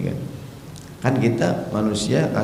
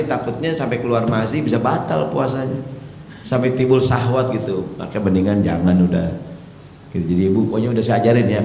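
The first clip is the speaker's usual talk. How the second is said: ordinary talk, nothing marked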